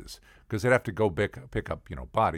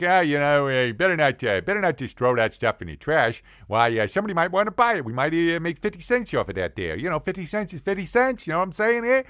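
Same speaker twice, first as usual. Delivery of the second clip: put-on haggler's patter, higher pitched; garage-sale seller; 6 semitones higher, 4 dB louder, faster than usual. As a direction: shrug it off as a penny-pinching seller. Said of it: "It's like".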